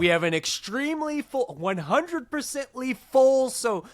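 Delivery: hammed-up fed-up crew member announcing a full flight; mock exasperated announcement. Voices exasperated boarding announcement.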